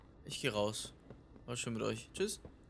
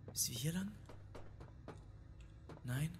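unvoiced whisper; hushed whisper creeping on; 3 semitones higher, 10 dB quieter than usual. Real, not acted. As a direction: breathe the question out. Hushed question while sneaking.

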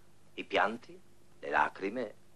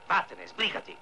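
angry dismissal; 6 semitones higher than usual. Acted. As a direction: shoo him off in irritation. Sends the man away. (cacciarlo via con stizza)

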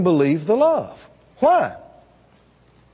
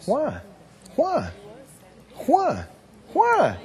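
each Why rising higher; repeated questioning why; 4 semitones higher, 5 dB quieter, slower than usual. Shown as a demonstration. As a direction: climb with every Why. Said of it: "repeated rising why".

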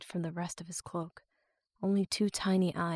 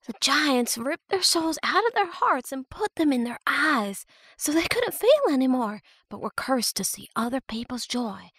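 put-on high girl's voice, telling a spooky tale; young girl telling legend; 6 semitones higher, 4 dB louder than usual. A narrator's reading. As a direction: recite the legend like a frightened child. Romani tells the ghost legend.

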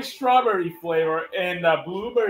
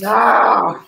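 alarm shout; 7 dB louder than usual. Real, not acted. Friend is about to pass him.

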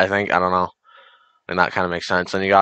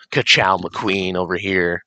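ordinary talk, nothing marked

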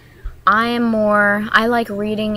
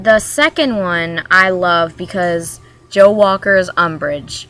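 ordinary talk, nothing marked

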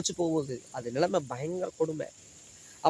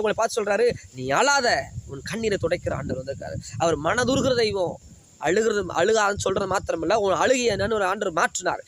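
higher, louder climactic pulpit preaching; fervent preaching; 4 semitones higher, 3 dB louder than usual. Acted. Pastor assuring sufferers God will lift them, fervent. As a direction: lift the promise high like a rallying cry.

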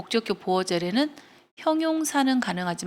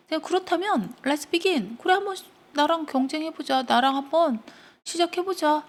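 higher-pitched character voice taking up a dare; acted challenge acceptance; 7 semitones higher than usual. Goddess and weaver agree to compete, higher-pitched acted voice. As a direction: take up the contest in a raised pitch.

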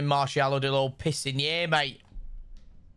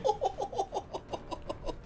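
airy laugh, pitched way up; high breathless laugh; 9 dB quieter than usual. Real, not acted. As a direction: burst into a wheezy laugh. Laughing at the appeal.

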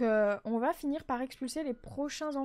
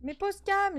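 high, louder insistence; insistent; 8 semitones higher, 5 dB louder, slower than usual. Real, not acted. Insisting the game put Cam down, raised. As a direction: repeat the order, raised. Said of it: "j'ai dit".

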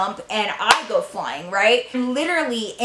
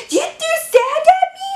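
put-on shrill shocked girl; 4 dB louder than usual. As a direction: squeal it like a stunned prissy girl. Lisa turns round, outraged.

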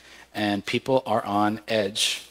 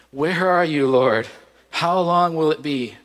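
louder pleading cry to the Lord; lament cry; 4 semitones higher, 3 dB louder than usual. Retold or imitated. Preacher leading the congregation's lament, a raised plea. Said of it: "this practice of lament and say".

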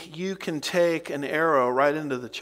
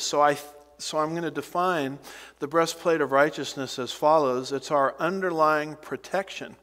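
ordinary talk

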